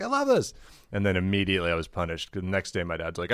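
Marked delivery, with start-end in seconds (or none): 0.0-0.5 much higher, delighted mom; high gushing mom voice. Retold or imitated. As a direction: gush like an excited mom. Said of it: "And she's like".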